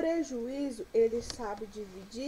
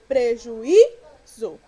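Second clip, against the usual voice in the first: "prejuízo" with a loud, drawn-out Í, then a pause before ZO; stress on Í; 14 dB louder, much slower than usual. Shown as a demonstration. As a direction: hit the Í of "prejuízo" hard and hold it. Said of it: "Qual é a sílaba tônica de prejuízo?". ("prejuízo" com o Í forte e alongado)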